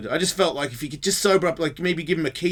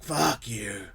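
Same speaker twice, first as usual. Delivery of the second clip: put-on drunk old man; 2 semitones lower, 5 dB quieter than usual. Drunk man rebuffs his helper, drunk dismissal. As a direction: mumble it like a drunk on the ground.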